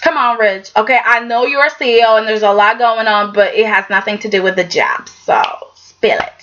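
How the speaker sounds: low-pitched imitation of coaxing. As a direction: lightly act out coaxing him to open up. Acting Caroline coaxing Rich.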